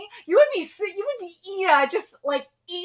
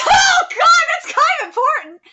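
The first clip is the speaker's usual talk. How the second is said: loud, high-pitched laughing disbelief; startled outburst; 13 semitones higher, 6 dB louder than usual. Real, not acted. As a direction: yelp it out in amused shock. Startled reaction to new information.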